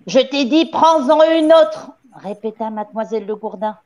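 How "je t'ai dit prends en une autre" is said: raised, louder command; insist, climbing in pitch and volume; stern insistence. A narrator's reading. Le Gourdin repeating her order to the gamin, sharp insistence.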